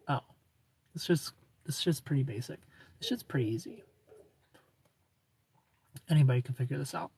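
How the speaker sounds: quiet, offhand overconfidence; blasé know-it-all. Acted. New psychology student thinking aloud, quietly blasé. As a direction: shrug it off softly, as if it's nothing.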